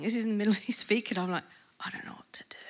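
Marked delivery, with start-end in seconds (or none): 1.8-2.6 hushed panicked whisper; whispering to himself, unsure what to do. Retold or imitated. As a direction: whisper it under your breath, lost. Panicked inner thought mid-sermon.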